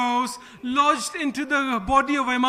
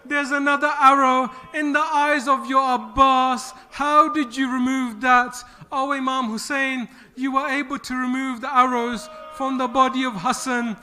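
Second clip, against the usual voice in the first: usual speaking voice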